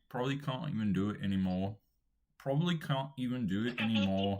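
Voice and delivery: put-on British, broad vowels; British accent. Shown as a demonstration. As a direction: slip into a practised British accent. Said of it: "only talking in a British accent".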